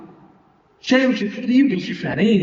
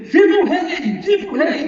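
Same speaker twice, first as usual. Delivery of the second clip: cheering; 9 semitones higher than usual. Acted. Uzai cheers Absalão outwardly.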